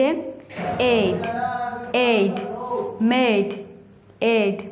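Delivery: एड, मेड, each with a long "ay" vowel; long A sound. Shown as a demonstration. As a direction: draw out एड as "ayd" and मेड as "mayd". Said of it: "ए लंबी आवाज़ देता है".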